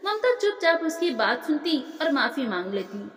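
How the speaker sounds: plain reading voice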